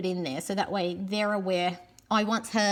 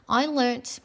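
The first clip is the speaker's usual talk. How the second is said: normal talking voice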